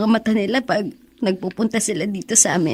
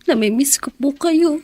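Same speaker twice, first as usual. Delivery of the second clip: longing tearful; 6 semitones higher than usual. Acted. Son missing dead father.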